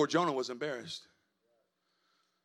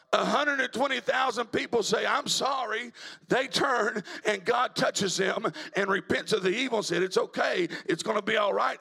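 full-force preaching; 4 semitones higher than usual. Real, not acted. Preacher telling church how Nineveh repented, full-force preaching. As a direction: drive the story home like a revival preacher.

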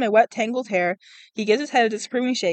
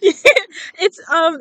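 nervous laughing; 11 semitones higher, 7 dB louder, slower than usual. Real, not acted. Nervous explaining to stranger.

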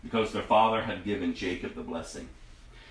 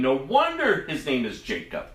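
higher, louder bitter protest; bitter exclaiming; 7 semitones higher, 4 dB louder than usual. Acted. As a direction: accuse the brother with rising anguish. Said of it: "And Esau exclaimed".

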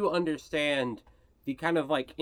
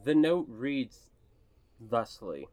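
ordinary talk, nothing marked

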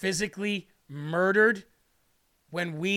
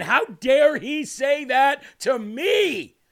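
loud, high mock outrage; indignant; 5 semitones higher, 6 dB louder than usual. Preacher imitating a proud listener's thoughts, indignant. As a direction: act out an offended, protesting listener. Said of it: "You gotta stop thinking".

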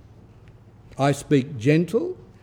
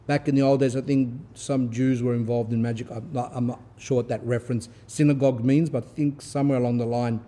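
ordinary talk

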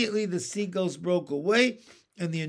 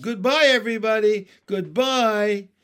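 fast, loud frantic goodbye; 4 semitones higher, 7 dB louder than usual. Acted. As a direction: shout a last goodbye. Men facing doom.

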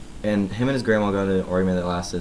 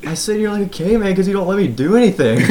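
high-pitched, louder imitation of protest; mock indignant retort; 11 semitones higher, 6 dB louder than usual. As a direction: whine back in mock outrage. Grandson's retort in retold argument.

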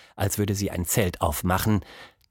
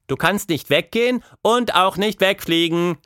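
put-on high-pitched child's call, slightly raised volume; 9 semitones higher, 4 dB louder than usual. A narrator's reading. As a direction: call out the rules to a hidden playmate. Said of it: "rief sie".

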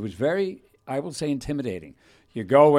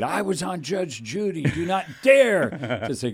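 mock yell; 6 semitones higher, 3 dB louder than usual. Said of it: "I yelled at the one kid".

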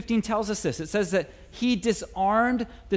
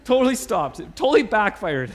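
laughing; 2 semitones higher, 5 dB louder than usual. Real, not acted.

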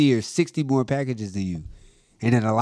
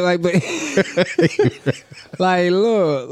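laughing; 6 semitones higher, 4 dB louder, slower than usual. Real, not acted. Breaks into laughter.

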